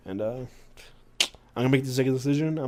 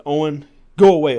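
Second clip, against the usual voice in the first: loud, higher brush-off; exasperated call; 5 semitones higher, 13 dB louder than usual. Acted. Wrestler fending off his prankster friend, exasperated. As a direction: call out to shoo him away.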